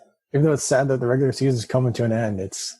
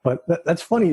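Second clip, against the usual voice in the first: usual speaking voice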